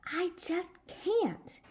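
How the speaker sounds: put-on high, slow, breathy vow of a small boy; determined boy. Sheldon to Lucille, a slow high vow. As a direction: stretch it out like a boy sure of his magic shirt.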